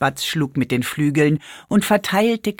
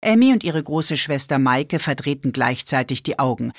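ordinary delivery, nothing marked

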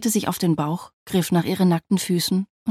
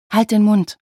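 louder, indignant retort; 3 semitones higher, 7 dB louder than usual. A narrator's reading. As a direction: cut off the teasing firmly. Teased wife tells him to stop.

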